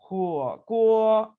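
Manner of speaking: teacher demo of 火锅; careful Mandarin model. Shown as a demonstration. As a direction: model 火锅 for learners. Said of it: "इसकी practice करेंगे".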